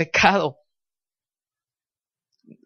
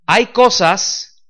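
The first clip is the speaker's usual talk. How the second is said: slow, deliberate and louder; slow emphatic paraphrase; 4 semitones higher, 4 dB louder, slower than usual. Shown as a demonstration. Pastor paraphrasing the verse for the congregation, slow and louder. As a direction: lay out each word for the congregation. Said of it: "en otras palabras diría así".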